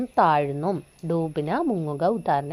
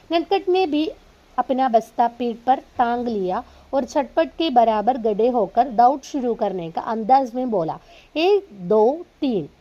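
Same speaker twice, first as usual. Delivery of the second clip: slow, deliberate read-aloud; teacher reading a story to students; 3 semitones higher, 4 dB louder, slower than usual. Acted. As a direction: read each word out carefully for the class. Reads Hindi story aloud.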